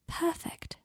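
tender whispered word. Acted. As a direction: breathe it tenderly.

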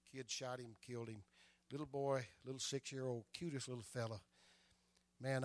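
ordinary talk, nothing marked

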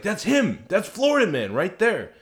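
high, loud thrilled outburst; excited recognition. Retold or imitated. Retelling grabbing a friend's leg, excited recognition. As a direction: exclaim it as if you just spotted your man.

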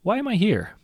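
put-on very high, loud girl's voice; high-pitched child character; questioning mermaid daughter. A narrator's reading. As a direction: ask father as a young girl. Mermaid girl questions the captain.

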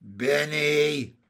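loud, drawn-out amused exclamation; laughing cry. A narrator's reading. Jessie reacts to Benny's tail plan, amused cry. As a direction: call the name out long, mock-scolding. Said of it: "cried Jessie, laughing".

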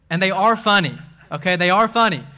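loud emphasis on are; insistent. Real, not acted. Pastor insisting to the congregation his jokes are funny, stressing are. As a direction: lean hard on "are" in "they are funny". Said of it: "insisting jokes are funny".

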